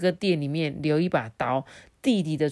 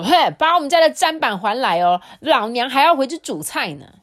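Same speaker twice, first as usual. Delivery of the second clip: much higher and louder, hands-on-hips scolding; a bossy wife demanding her board back; fierce housewife; 8 semitones higher, 8 dB louder than usual. Acted. Fierce wife with hands on hips demanding her chopping board, scolding loudly. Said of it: "凶一点的太太呢，还叉着腰说".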